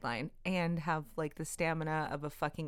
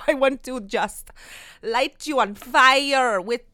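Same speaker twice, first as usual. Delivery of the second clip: much louder, fired-up gush; fiery passion; 3 semitones higher, 10 dB louder than usual. Acted. Host pouring her passion out to listeners, fired up. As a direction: set the listener on fire with it. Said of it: "oozing with this excitement and passion".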